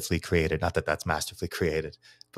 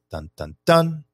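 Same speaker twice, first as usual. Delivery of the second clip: three beats climbing higher and louder, "dun" stressed last; rising anapest beat; 9 semitones higher, 4 dB louder than usual. Shown as a demonstration. Teaching students the rising anapest rhythm. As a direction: chant dun dun DUN rising to a loud peak. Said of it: "An anapest is".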